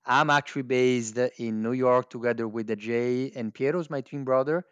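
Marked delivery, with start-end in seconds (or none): none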